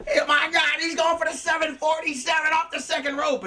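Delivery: high, sustained excited announcer cry; alarmed play-by-play, voice pitched far up; frantic commentator. Retold or imitated. Commentator warning of the seven forty-seven, frantic excitement.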